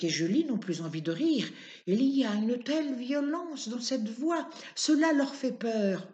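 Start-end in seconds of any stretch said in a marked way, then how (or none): none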